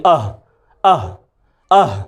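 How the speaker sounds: breathy ḥa from mid throat, like a cold breath; «अह» breathed out softly from the middle of the throat. Shown as a demonstration. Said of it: "वही आवाज़ निकालनी है".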